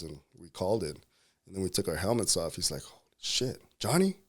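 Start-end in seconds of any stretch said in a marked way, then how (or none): 2.8-4.2 put-on high, amazed exclamation as the old friend; imitating a stunned buddy recognizing him; retold disbelief, pitched up; mock surprise.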